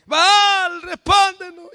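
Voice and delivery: high, very loud wailing call; acted desperate shouting; shouted invocation. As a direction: cry out to an absent god. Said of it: "Y todos gritan".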